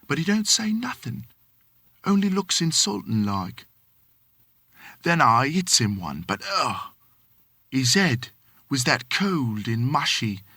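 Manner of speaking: lightly voiced Cockney manservant, deferential; a hint of London Cockney, polite to his master; Cockney servant. Thomas answering his employer, light Cockney. Thomas tells of hitting him.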